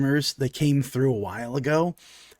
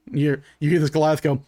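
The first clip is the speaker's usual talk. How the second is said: ordinary delivery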